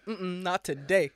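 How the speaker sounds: slow, drawn-out "not today"; emphatic, unhurried mock refusal; acted refusal. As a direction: repeat the punchline as a slow refusal. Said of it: "He's like".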